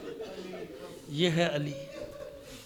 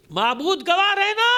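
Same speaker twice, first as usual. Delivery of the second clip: raised plea to God; 10 semitones higher, 5 dB louder than usual. Acted. Fatima calls God as witness.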